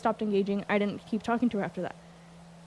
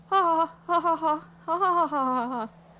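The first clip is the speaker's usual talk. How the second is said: spelled laugh; 5 semitones higher than usual. Real, not acted. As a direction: laugh it out, ha after ha. Spelled-out laugh after her retort.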